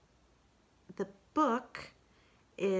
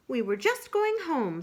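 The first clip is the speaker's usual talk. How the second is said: lightly higher motherly kangaroo; 8 semitones higher, 5 dB louder than usual. Acted. Kanga tells the friends they're leaving, higher. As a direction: say it like a busy mother heading home.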